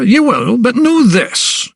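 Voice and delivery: slow it down and warn him; threatening warning. A narrator's reading. Principal warning the doctor, slow menacing threat. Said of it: "threateningly in the air".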